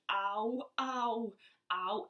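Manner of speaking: retold groans of stomach pain; voicing the pain again. To Mum and Rebecca in the shop, mock pained ows.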